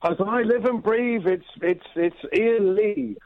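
slow, exclaiming mock-Cockney; Cockney accent. Shown as a demonstration. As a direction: greet him in a playful Cockney accent. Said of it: "That's my Cockney accent for you".

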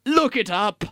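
ordinary talk, nothing marked

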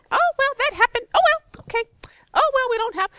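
relentlessly happy, very high loud chirp; Mary. Retold or imitated. Imitating an always-happy friend brushing off trouble, cheerily high. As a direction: shrug off trouble in a giddy high voice. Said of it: "She was just happy".

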